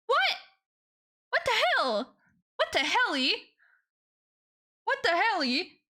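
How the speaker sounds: high squeaky disbelief; shocked squeal. Real, not acted. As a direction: shriek it in surprise. Surprise at a donation.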